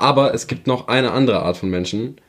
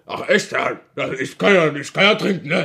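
higher, taunting put-on voice; lightly voiced smug acquaintance; mocking imitation; 3 semitones higher than usual. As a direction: gloat about drinking in front of someone fasting. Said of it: "Die extra so".

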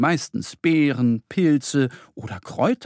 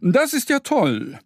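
much higher, slow delighted exclamation; delighted cry; 10 semitones higher than usual. A narrator's reading. Pharmacist stag cheers about the sage, higher delighted. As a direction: clap and cheer at the find. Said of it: "klatscht in die Hände und ruft".

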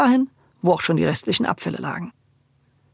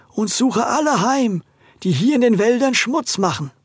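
empty suit of armor; 5 semitones higher than usual. Acted. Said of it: "sprach er mit hohler Stimme".

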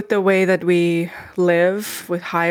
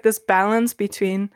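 usual speaking voice

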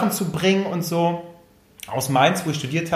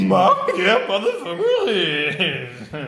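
very slow laughing repeat of the full name; laughing exclamation; much slower than usual. Real, not acted. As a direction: drag out the name while laughing. Repeats shocked outburst.

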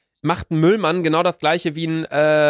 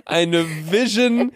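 stifled giggles; 4 semitones higher, slower than usual. Real, not acted. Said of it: "hör auf zu lachen".

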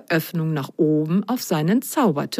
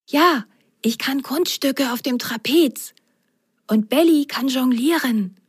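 higher sock voice, hushed; whispering Alto; 6 semitones higher than usual. Acted. Alto whispering his answer to the magician. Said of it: "Alto antwortete ihm flüsternd".

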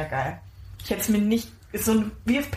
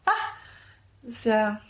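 usual speaking voice

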